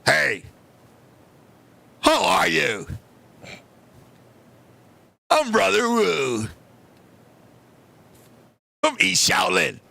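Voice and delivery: low, slow, booming kung fu master voice; deep, drawn-out mock Shaolin greeting; mock kung fu monk. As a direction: play a solemn Shaolin monk from a martial arts film. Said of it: "and I said".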